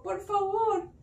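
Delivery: pleading request. A narrator's reading. Sita begs Rama for the deer, pleading. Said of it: "ella le pidió".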